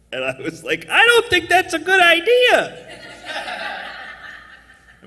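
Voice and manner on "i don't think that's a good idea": very high, loud and nervous; high-pitched panicky protest with a laugh; high squeaky nervous refusal. Retold or imitated. Acting his own reluctant reply.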